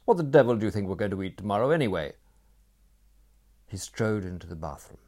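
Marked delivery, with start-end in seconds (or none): 0.0-2.2 fast irritated snap, louder; snapping. A narrator's reading. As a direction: fire it off impatiently. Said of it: "snapped Franz".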